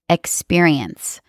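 "experience" set apart by a pause, said slowly; slow, weighty stress on "experience"; emphasis on experience. Real, not acted. Podcast therapist defining trauma for listeners, emphatic "experience". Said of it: "I emphasize that word".

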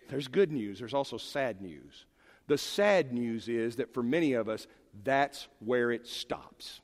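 ordinary talk, nothing marked

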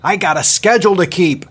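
loud, high impatient shout; impatient yell. Acted. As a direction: snap it back, no time to waste. Captain yelling impatiently.

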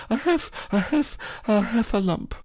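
high breathless stammer; out of breath. Acted. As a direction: gasp it out, barely able to speak. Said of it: "breathing so hard that he could hardly speak".

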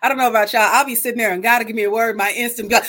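usual speaking voice

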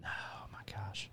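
slow whispered dismay. Real, not acted. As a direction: breathe it out in disbelief. Dismay at well-done meat.

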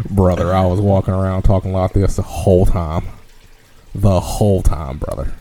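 a Texas good ol' boy telling his trip, hammed-up; mock Texan accent. Podcast host tells his Texas trip in a mock Texan accent. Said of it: "I had to get out of character for a second".

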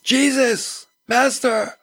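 high, far-carrying call for Jesus; a blind man calling out to Jesus from the roadside. A narrator's reading. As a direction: cry out to him across the crowd. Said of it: "he begins to yell out for Jesus".

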